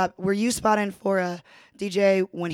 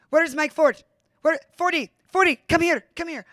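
mock call across the room, pitched much higher; imitating a call out; 8 semitones higher, 4 dB louder than usual. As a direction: call out for Fordy as the owner did, voice up high. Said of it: "and he turns and he goes".

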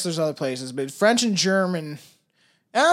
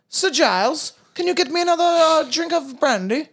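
higher, haughty toff summoning the butler for brandy; posh master to butler; 9 semitones higher than usual. Pretend. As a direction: lord ordering his butler, pitched higher. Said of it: "it reminds me of, like, a butler".

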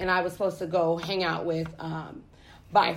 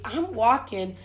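ordinary delivery

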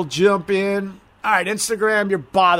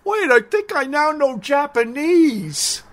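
higher, slow, dopey inner voice; naive inner voice; 8 semitones higher, slower than usual. Retold or imitated. Viewer's brain fooled by subtitles, slow dopey voice. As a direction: play the fooled brain, slow and wide-eyed. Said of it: "you're like".

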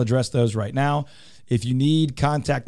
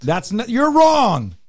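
mock outrage; 11 semitones higher, 5 dB louder, slower than usual. Mock outrage in a word argument.

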